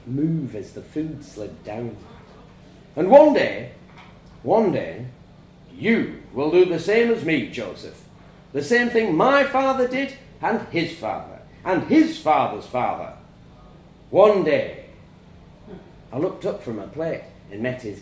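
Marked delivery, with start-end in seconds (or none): 2.9-14.9 proud, louder father holding forth at dinner; a father preaching tradition to his family; stern farmer father lecturing. A narrator's reading. Father lecturing his son over Sunday dinner, proud and louder.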